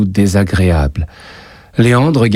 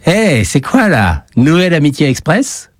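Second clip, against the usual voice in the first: mocking bully voice; 9 semitones higher than usual. Schoolyard bully mocking two new friends, higher sneering voice. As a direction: taunt the new pair from across the yard.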